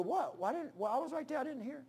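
soft, high-pitched confusion; bewildered listener. Acted. Churchgoer who missed the sermon, bewildered soft protest. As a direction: act baffled, voice up and small.

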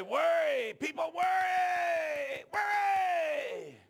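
high, slow shouted repetition of worry; preacher's shout. Retold or imitated. Preacher quoting Brother Earl, loud and slow. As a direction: belt the word worry out slowly.

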